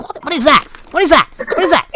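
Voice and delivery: high, alarmed repeated question; startled. Retold or imitated. Retelling the drop bear attack, high and startled. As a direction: jump at something unseen at your feet. Something bites his leg in the dark.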